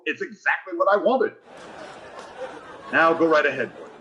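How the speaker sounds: usual speaking voice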